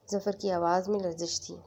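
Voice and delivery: usual speaking voice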